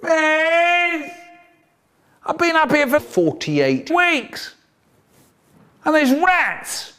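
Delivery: high, drawn-out wail; hammed-up suffering child, pitched right up; pleading pupil. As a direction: whine to teacher from up high. Punished pupil wailing from wall bars.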